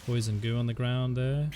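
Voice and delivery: energetic live play-by-play; esports caster. Real, not acted. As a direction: call the round as it unfolds.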